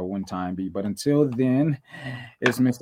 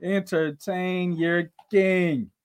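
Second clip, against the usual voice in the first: slow regal command; 7 semitones higher, much slower than usual. Acted.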